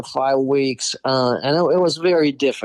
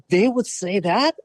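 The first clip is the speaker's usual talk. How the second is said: mildly incredulous, higher rising question; disbelieving; 6 semitones higher than usual. Retold or imitated. Wife questions husband about the boys' talk, mildly disbelieving. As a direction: lightly imitate a wife who can't believe it.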